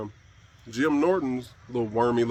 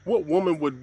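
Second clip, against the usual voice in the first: normal talking voice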